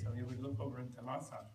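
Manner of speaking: ordinary delivery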